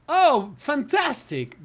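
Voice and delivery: high, slow, delighted exclamation; high tone for good news. Shown as a demonstration. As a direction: lift the pitch high and draw it out. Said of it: "That is high".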